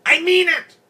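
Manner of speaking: very high, loud screech; furious shout. A narrator's reading. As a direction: shriek it to insist. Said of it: "Farrah shouted".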